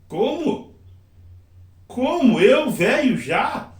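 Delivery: high, swooping "Como? Eu, velho, já?"; questioning intonation. Shown as a demonstration. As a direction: pitch leaps up on "Como" and "Eu", falling on "já". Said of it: "aí vem a entonação".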